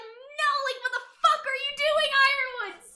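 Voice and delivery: high-pitched, dismayed protest; disappointed Weiss. Retold or imitated. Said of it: "She's just so disappointed".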